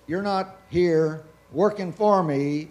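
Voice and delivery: ordinary talk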